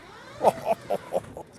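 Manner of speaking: high, whooping laugh; thrilled laugh. Real, not acted. Thrilled by the mower's speed.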